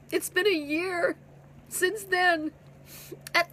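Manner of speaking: real tears through the words; choked-up, tearful; crying. Real, not acted. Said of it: "I'm crying in front of you".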